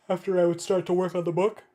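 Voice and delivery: yawning through the words; words stretched by a yawn. Real, not acted. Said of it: "pardon my yawning".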